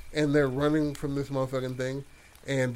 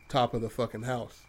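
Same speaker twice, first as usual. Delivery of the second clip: plain reading voice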